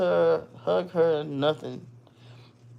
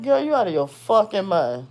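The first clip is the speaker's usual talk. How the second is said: incredulous; 5 semitones higher, 5 dB louder than usual. Retold or imitated. Refusing expensive dress.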